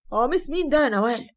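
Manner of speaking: angry husband yelling at his wife; shouting. Acted. Husband confronting wife over a strange shirt, shouting. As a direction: raise the voice, accusing. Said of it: "ساعتها لقيته بيزعق وبيقول".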